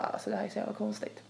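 soft, muttered bewilderment; muttered disbelief. Retold or imitated. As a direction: mutter it under your breath, baffled. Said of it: "Jag bara".

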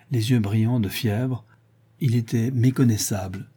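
ordinary delivery

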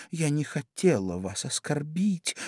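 high, soft plea; pleading. A narrator's reading. Alice apologises to offended mouse, pleading softly. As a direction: plead softly in a girlish pitch. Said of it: "взмолилась Алиса".

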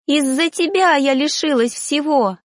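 high, bitter accusation; angry cry. A narrator's reading. Ghost blames stepfather for her death, crying out. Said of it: "закричала душа".

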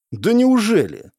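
high, slow feigned surprise; drawn-out fake astonishment; mock surprise. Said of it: "Вроде как удивился непонятный тип". (наигранное удивление)

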